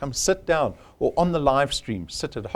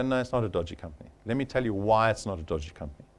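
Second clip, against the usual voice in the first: softer, lower, fast reassurance; reassuring rebuttal; 7 semitones lower, 7 dB quieter, faster than usual. Retold or imitated. Recruiter imitating his answer to a doubting prospect, soft and quick. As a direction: calmly talk them round.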